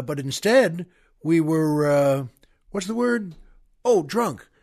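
usual speaking voice

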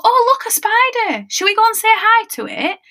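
put-on high, singsong parent-to-toddler voice; put-on cheerful delight. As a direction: act delighted to show a small child a spider. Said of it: "I would then go".

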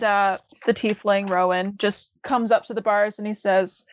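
ordinary talk

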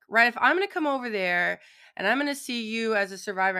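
ordinary talk

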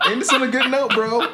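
laughing loudly. Real, not acted. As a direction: repeat it through big laughter. Repeated louder through laughter.

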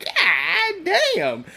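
high-pitched mock-awed exclamation; stunned, high squeal; retold amazement. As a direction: draw it out high, imitating someone stunned by the view. Acting out amazement on arrival.

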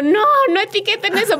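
high, loud mock-panicked plea; acted-out "stop it" outcry; retold pleading protest. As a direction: exclaim in comic alarm, pitch shooting up. Retells her plea to stop tagging.

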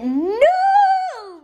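high, loud furious no; shout. Acted. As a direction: yell it out. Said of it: "Miss Cuddy shouted".

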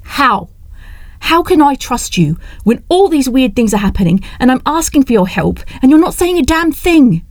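mildly heated, rising snap; a touch of angry frustration; snapping. Acted. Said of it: "she snapped".

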